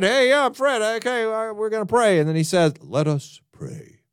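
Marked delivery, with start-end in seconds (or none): 0.0-2.2 high, chummy call to Fred; casual church-leader greeting, pitched up; breezy preacher hailing Fred; friendly pastor. Retold or imitated. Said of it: "and good old Fred". 2.8-4.0 slow, solemn mock-reverent intoning; hammed-up holy voice to open prayer; venerable prayer voice. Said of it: "I'm not exaggerating at all".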